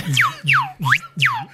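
high whistled laser sound; laser whistle. Shown as a demonstration.

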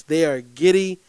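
ordinary delivery

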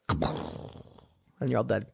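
slow, hushed mock-doom ending; dramatic hush. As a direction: drop it low and slow like a grim punchline. Dramatic punchline after crash.

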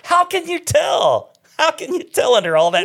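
squeaky mock outrage; mock disbelief. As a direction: act amazed nobody could tell. Mock disbelief over hidden muscles.

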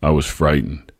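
usual speaking voice

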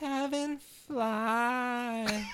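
very slow, drawn-out wonder; dazed, drugged character. Acted. Drugged Skylar reaching up toward a kicked friend, slow dreamy awe. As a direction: stretch each word, lost in a daze.

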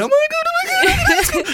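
very high, laughing shout of joy; inner fan squeal. Retold or imitated. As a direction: shriek inwardly like a thrilled fan. Inner fan voice mid-conversation.

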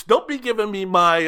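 normal talking voice

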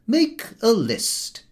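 high-pitched uncle dictating a packing rule; Uncle Podger. Acted. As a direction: play the methodical uncle laying down the rules. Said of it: "my uncle would say".

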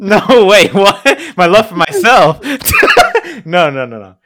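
real high, very loud laughing outburst; laughing protest. Real, not acted. Teased about his love, loud laughing protest. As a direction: shout the denial through laughter.

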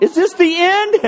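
high frightened question; mock panic. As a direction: play the freaked-out stranger. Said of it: "freaks people out".

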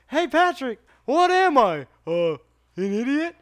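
high, loud cartoon bit, slow at the end; hammed-up SpongeBob. Reenacting the Texas joke for friends, high and loud. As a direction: ham up the cartoon exchange.

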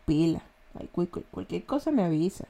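low, soft Guayaquil street talk, as if hiding something; Guayaquil accent. Shown as a demonstration. Showing listeners a Guayaquil street warning, low furtive Guayaquil accent. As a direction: lightly imitate a furtive Guayaquil tough. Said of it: "Así habla el ecuatoriano, el guayaquileño".

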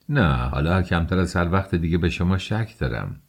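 plain reading voice

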